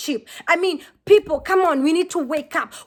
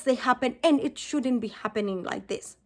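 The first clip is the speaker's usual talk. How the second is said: holding back real emotion as it fades; close to tears; 3 semitones lower, 7 dB quieter than usual. Real, not acted. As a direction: voice dropping low and quiet, near tears. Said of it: "before I get emotional".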